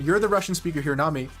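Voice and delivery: usual speaking voice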